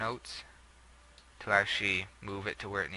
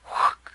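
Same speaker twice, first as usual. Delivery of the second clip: loud, breathy "whook" swoosh; quick whooshing sound effect; imitated whoosh; 10 dB louder than usual. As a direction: mimic something whipping suddenly away. Imitates orbit swinging off course.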